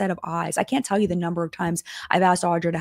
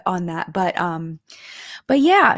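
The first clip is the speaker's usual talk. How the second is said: ordinary talk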